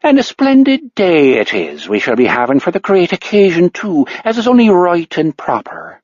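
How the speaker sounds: put-on Irish accent from old movies; stage Irish accent. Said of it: "Her Irish accent is surprisingly good".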